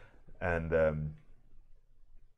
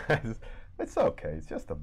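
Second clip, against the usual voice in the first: softer, hushed comfort ending in a whisper; calm soothing; 5 dB quieter, faster than usual. Retold or imitated. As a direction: coo gently to a hurt kid.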